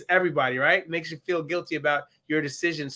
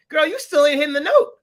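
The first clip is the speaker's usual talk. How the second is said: sassy jab, pitched up and louder; sassy mock; 8 semitones higher, 6 dB louder than usual. Mocking a singer who keeps missing the note, sassy. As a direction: tease her for missing the note.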